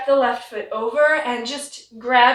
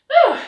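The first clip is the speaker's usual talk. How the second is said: a short breathy exhale; winded whew; 13 semitones higher, 6 dB louder than usual. Real, not acted. Teacher recovering her breath mid-twist, winded whew. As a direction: puff out the air, still winded.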